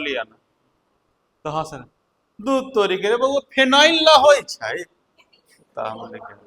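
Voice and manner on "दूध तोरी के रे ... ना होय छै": louder, higher teasing aunt in rural dialect; a neighbour aunt scolds the boy over the word, teasing and raised; mocking village aunt. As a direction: laugh at the boy as an aunt correcting him. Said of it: "वो हंसने लगी".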